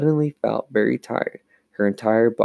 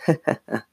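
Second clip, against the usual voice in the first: short acted giggle; a brief light ha-ha-ha; laugh; 4 semitones higher than usual. A narrator's reading. Sister revealing her prank, short gleeful laugh. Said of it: "closed her eyes and laughed".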